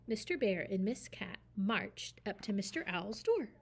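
ordinary delivery, nothing marked

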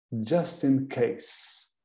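Just in case stretched out slowly and softly; slow demo. Shown as a demonstration. Teacher slowly modelling just in case for the class. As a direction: lay out just in case word by word. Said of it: "Just in case usa-se muito".

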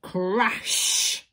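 loud, drawn-out crash; crashing sound effect. A narrator's reading. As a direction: say it like something huge smashing down. Storyteller voices the giant's fall.

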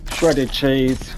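ordinary talk, nothing marked

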